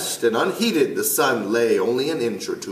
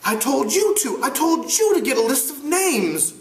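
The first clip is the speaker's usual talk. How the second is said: put-on higher boy's voice, firm; Ralph's voice. Ralph reproaching Piggy, pitched up and firm. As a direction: press it like a frustrated young leader.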